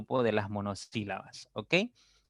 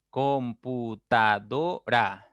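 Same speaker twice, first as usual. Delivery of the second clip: "Computadora" slowly as com - pu - ta - do - ra; syllable by syllable; much slower than usual. Shown as a demonstration. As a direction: break "Computadora" into five slow beats, com, pu, ta, do, ra. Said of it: "Tiene cinco sílabas también".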